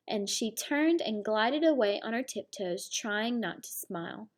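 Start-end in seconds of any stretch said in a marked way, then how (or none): none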